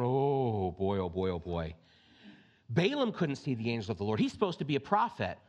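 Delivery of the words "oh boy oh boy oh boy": fading into a low groan of dread; caught-out sinking voice; mock dread.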